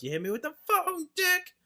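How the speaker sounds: high-pitched yell, loudest on the last word; hammed-up wounded shout; imitated yell. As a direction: yell it as an outraged, whiny mimicry. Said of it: "and he yells".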